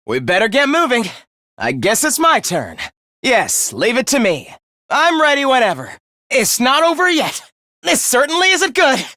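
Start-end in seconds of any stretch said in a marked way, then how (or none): 4.9-9.0 urgent, high-pitched combat lines; battle call-out. Acted. Fighter calling out mid-battle, urgent call-out.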